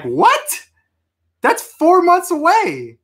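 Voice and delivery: high-pitched, drawn-out incredulous outburst; shocked disbelief. Retold or imitated. Customer hearing the April street date from the manager, incredulous. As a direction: let the voice shoot up in reenacted astonishment. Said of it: "I literally said it like that".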